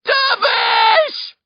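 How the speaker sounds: high, urgent roared call; desperate high-pitched yell. A narrator's reading. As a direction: roar the name to rouse him. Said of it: "I roar".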